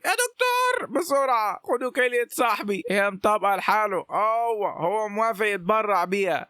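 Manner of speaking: high-pitched, urgent cry for help; acted panicked plea to the doctors; screaming at doctors. A narrator's reading. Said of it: "وقعدت تصرخ في الدكاترة وتقول".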